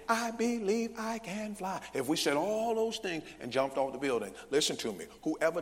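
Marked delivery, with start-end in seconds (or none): 0.1-1.8 high, slow, evenly hammered words; mock recitation stressing everything; every word stressed. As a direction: chant each word of "I believe I can fly" up high.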